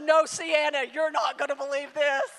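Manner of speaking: loud, excited burst to the daughter; excited outburst. Retold or imitated. Mother bursting out the news to her daughter, loud and excited. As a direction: blurt out the big news.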